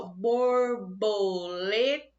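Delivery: "borboleta" said very slowly as bor-bo-le-ta; split into syllables. Shown as a demonstration. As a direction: sound out "borboleta" in four beats. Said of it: "eu pronunciei quatro sílabas".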